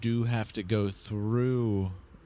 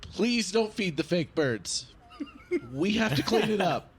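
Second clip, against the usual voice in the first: uniformed zoo worker; 12 semitones higher than usual. Retold or imitated. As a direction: beg like a tired cleaner. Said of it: "They're wearing a uniform and they're like".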